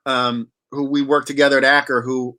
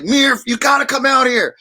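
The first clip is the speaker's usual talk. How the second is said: an imitated shout, higher and louder; calling out; 9 semitones higher, 3 dB louder than usual. A friend shouting the invite, imitated shout. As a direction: call it out like an eager friend.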